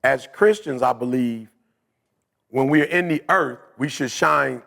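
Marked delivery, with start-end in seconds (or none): none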